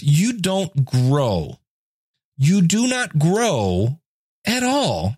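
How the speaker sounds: drawn out, lifting on at all; slow emphatic stress. Real, not acted. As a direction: spell it out slowly, punching not. Hammering home a lesson.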